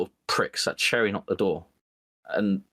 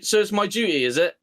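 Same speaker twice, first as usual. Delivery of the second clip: sarcastic gotcha; 8 semitones higher than usual. Retold or imitated. Turning the duty debate back on his wife, smug and sarcastic. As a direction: throw her words back smugly.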